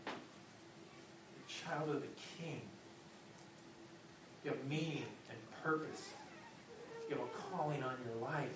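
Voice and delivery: soft, hushed intimate voice; preacher speaking as God in a whisper. Acted. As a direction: whisper it close, like God to Elijah. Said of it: "let me whisper it".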